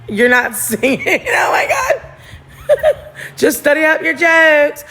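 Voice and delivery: high, slow laughing shriek. Real, not acted. Cracking up at Rose, shrieking with laughter. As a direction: squeal it out through laughter.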